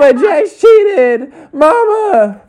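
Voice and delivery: very high, drawn-out mock sobbing; Brittany wailing. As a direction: tattle to mama in a hammed-up wail. Mimics Brittany calling mother.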